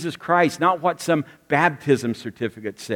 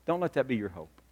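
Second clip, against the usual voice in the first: normal talking voice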